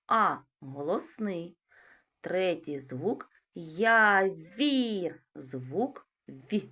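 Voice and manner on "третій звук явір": slow, drawn-out "Явір" with the sound being counted stretched; sound-by-sound articulation. Shown as a demonstration. Teacher stretching the word for sound analysis, slow. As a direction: draw out "Явір" slowly so each sound is heard. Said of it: "Перший звук Й".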